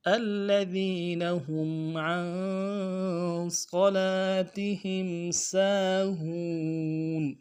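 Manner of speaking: slow melodic tajweed chant; Quran recitation. Shown as a demonstration. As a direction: recite the verse with care.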